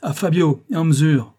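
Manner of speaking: high, loud call; shouted order. A narrator's reading. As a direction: shout the next pupil into action. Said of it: "en criant".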